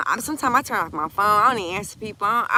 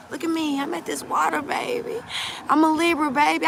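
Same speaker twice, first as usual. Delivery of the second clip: high-pitched, emotional near-crying voice; near tears; 7 semitones higher than usual. Real, not acted. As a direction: say it high, as if about to cry. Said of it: "I could cry right now".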